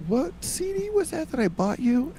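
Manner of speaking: high suspicious mom voice; mom's voice. Retold or imitated. Mom questioning son about the CD, high prim. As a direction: put on a prim mother. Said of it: "she says".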